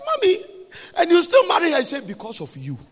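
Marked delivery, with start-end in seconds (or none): 0.1-1.8 very high, loud incredulous question; acted disbelief. Daughter learning what her mother put up with, acted shocked cry. 2.1-2.8 lower, softer acted reply; low, quiet answer. Mother answering why she stayed married, low and quiet.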